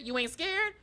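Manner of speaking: high-pitched sing-song taunt from Pennywise; mock taunting clown. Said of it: "talking about some".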